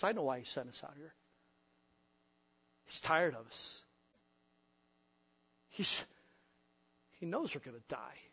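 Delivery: low, near-whispered bitter muttering; fast, dark, low murmur; hushed accusation. Acted. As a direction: say it under your breath so the others won't hear.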